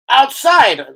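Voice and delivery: high, loud indignant local man; mock country man. Telling the story of a villager scolding him over litter, high indignant voice.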